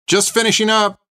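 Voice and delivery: high-pitched call to the next room; calling back. A narrator's reading. Cook to impatient soldiers next door, calling back high. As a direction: call back that you're busy. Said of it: "she called back".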